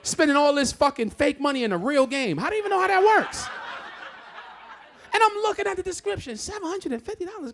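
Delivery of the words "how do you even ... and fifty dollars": exasperated disbelief. Real, not acted. Dad reacting to charges.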